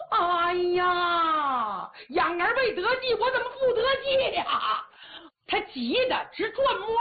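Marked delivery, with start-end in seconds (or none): 0.1-4.9 rising, high distressed outcry; anxious wail. Acted. Hu Dahai surrounded and desperate, high rising anxious wail. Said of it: "胡大海这可急了".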